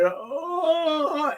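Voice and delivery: long, high drawn-out "oh"; mock pained groan. Fan imitates Zod having his hand crushed, high mock groan. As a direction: hold a high imitated moan of pain.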